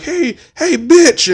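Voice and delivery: high laughing taunt; mock heckler. Heckler taunting the Deacon, laughing. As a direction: jeer with a laugh. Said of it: "He was like".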